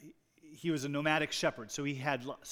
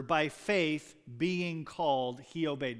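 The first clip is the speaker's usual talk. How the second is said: By faith being called spoken very slowly, the word faith lifted; Greek word order, emphasized; slower than usual. Shown as a demonstration. Preacher showing the congregation the Greek emphasis, slow and deliberate. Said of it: "emphasizes the timing of the call".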